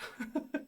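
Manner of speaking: short breathy laugh; soft laugh. Real, not acted. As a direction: let a quiet laugh slip out. Laughs at own joke.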